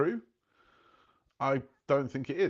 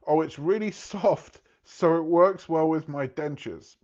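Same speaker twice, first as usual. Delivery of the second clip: put-on elderly diner, giggling; 5 semitones higher, 6 dB louder than usual. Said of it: "one of them actually said".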